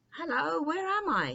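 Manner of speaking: cried out. A narrator's reading. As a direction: call out in wonder, high and loud. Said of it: "he cried".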